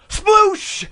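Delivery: high, sudden imitated sploosh; loud splash imitation. Storyteller mimicking the icy spilling on his lap, sudden high sploosh. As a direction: burst out with a high splash noise.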